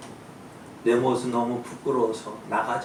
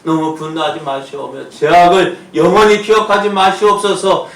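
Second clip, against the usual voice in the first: crying out the prayer, louder and higher, slowing on the last line; preacher shouting a prayer for the people; full-force preaching prayer; 7 semitones higher, 13 dB louder, slower than usual. Acted. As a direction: pour it out to God at full volume. Pastor leads prayer at full force.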